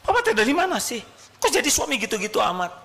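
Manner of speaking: high, fast scolding wife voice; nagging wife. Retold or imitated. Wife scolding husband coming home, high fast nag. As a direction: play the wife chewing him out.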